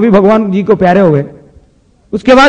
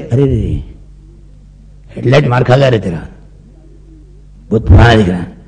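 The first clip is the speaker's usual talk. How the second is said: much lower, mock-serious mechanic doctor; a low, gruff quack doctor; 10 semitones lower than usual. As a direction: diagnose him like a grumpy mechanic.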